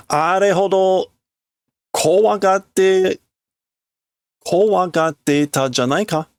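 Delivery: man recalling how scared she was, slow and high; character voice. Acted. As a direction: stretch the words in disbelief.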